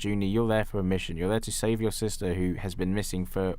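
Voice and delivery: ordinary talk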